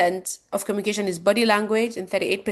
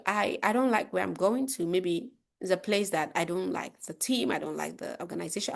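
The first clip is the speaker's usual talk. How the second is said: disengaged; 7 dB quieter than usual. Shown as a demonstration. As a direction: let the volume sag as if checked out. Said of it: "I'll be disengaged".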